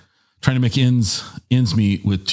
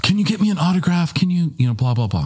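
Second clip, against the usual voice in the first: high-pitched imitation of an overexcited daughter; mock teenage pleading, pitched up; beside herself; 9 semitones higher than usual. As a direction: beg excitedly in a raised voice, trailing into blahs. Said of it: "beside herself".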